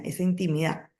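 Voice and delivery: ordinary talk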